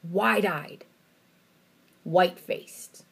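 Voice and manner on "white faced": ordinary talk, nothing marked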